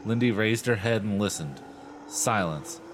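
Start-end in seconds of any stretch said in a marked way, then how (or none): none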